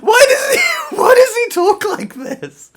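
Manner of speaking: high, loud, disbelieving shout; exasperated shout. Real, not acted. Reviewer exasperated by the hero's dialogue, shouting. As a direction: cry out in real exasperation.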